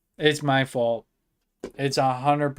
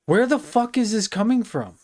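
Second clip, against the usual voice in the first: higher and louder, fed-up disbelief; exasperated outburst; 6 semitones higher, 4 dB louder than usual. Real, not acted. Streamer baffled by audio leaking from nowhere, exasperated. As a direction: snap it out in frustration.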